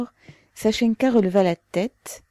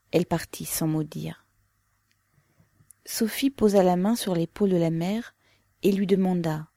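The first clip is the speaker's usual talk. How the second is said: usual speaking voice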